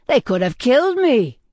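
loud, high shaken protest; indignant alarm. A narrator's reading. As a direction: exclaim it, appalled at the near miss. Shaken man protests after stone attack.

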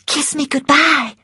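high, urgent plea; pleading Scarlett. A narrator's reading. Scarlett urging Ashley for a goodbye kiss, high. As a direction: beg for one kiss.

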